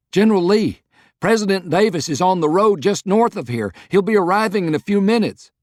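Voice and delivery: high, loud and breathless with news; urgent excited report. Acted. Aide rushing to tell General Lee, high and loud urgent report. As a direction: burst in announcing the president is coming.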